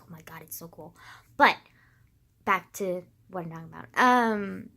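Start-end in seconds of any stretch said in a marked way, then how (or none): 0.0-1.0 whispered awe. Retold or imitated. Quoting own awe at history.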